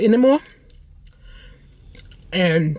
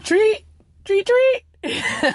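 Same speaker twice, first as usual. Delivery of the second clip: high squeaky little chirps; very high, teasing pet voice; excited pet call; much slower than usual. Real, not acted. Calling her pet for a treat.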